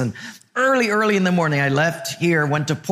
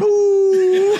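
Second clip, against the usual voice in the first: high loud mock dog howl; 6 dB louder than usual. Pastor imitating the crying lady's dog with a howl. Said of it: "the dog was going".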